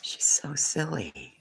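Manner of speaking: hushed slow amused whisper. Real, not acted. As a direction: whisper it fondly so she won't hear. Whispering about someone nearby.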